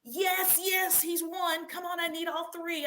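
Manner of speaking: normal talking voice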